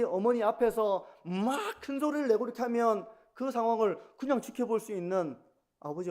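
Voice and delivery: usual speaking voice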